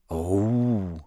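low, drawn-out dark murmur. A narrator's reading. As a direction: let it sink darkly. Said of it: "said George darkly".

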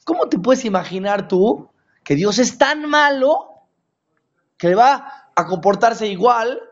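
very high, slow outraged disbelief; incredulous outcry. Real, not acted. Preacher rejecting the idea to the congregation, high and incredulous. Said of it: "¿cómo crees?".

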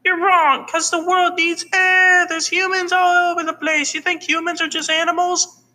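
extremely high squeaky cartoon voice; squeaky arguing character. Character arguing about humans, squeaky and insistent. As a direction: argue in a tiny, piercingly high voice.

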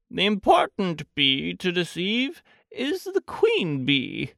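high, deliberate Pooh; practical-minded storybook bear. Acted. Pooh plans to fool the queen bee, high and earnest. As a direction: lay out the plan earnestly, pitched high.

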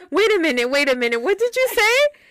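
high, loud stunned question; shocked friend. Retold or imitated. Friend Karen hearing the story, loud high disbelief. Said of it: "she's like".

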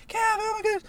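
high, loud excited squeal, imitating; mock-thrilled high-pitched mom. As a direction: gush like a thrilled mom waving someone over. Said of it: "Mom would've threw her hands up".

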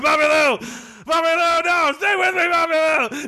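high, hammed-up wail over a dying friend; mock anguished plea. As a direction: plead with him to stay, overdone. Holding dying friend, pleading.

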